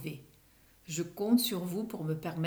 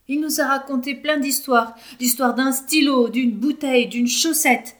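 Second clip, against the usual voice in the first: higher, louder enumeration; lively listing; 6 semitones higher, 10 dB louder than usual. A narrator's reading. Librarian telling the director about the stories, animated. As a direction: rattle off the stories with relish. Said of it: "dit le bibliothécaire".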